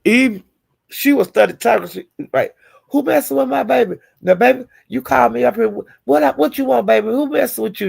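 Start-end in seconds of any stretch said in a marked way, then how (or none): 2.9-7.9 put-on high, cooing mama coddling her baby; sweet mama voice. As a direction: imitate a doting mother soothing her baby. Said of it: "that's how she was talking to".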